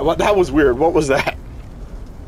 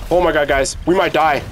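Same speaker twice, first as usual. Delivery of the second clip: ordinary delivery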